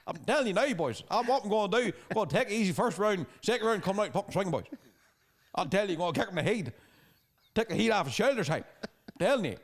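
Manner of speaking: hammed-up Ballymena hard man swagger; Ballymena fighter impression. Fighter trash-talking UFC opponents, in a Ballymena accent, hammed up. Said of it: "that's a pretty good Reece impersonation".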